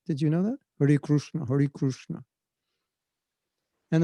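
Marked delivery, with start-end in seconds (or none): 0.8-2.2 "Krishna" pronounced "Krooshna"; regional Indian pronunciation. Shown as a demonstration. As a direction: say "Krishna" with an oo vowel. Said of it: "In some places, they chant".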